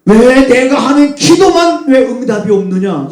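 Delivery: high, loud plea of why; complaining prayer. Acted. Preacher voicing a believer's unanswered prayer, loud plea. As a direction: cry it up to heaven in frustration. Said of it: "이렇게 말하는 것과 똑같아요".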